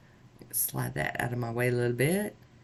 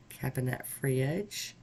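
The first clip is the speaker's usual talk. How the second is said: usual speaking voice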